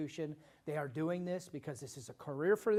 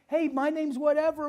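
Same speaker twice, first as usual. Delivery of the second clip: mock friendly greeting; 11 semitones higher, 11 dB louder than usual.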